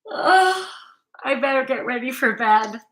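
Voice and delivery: yawning through the words. Real, not acted. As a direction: say it mid-yawn. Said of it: "Before I yawn again".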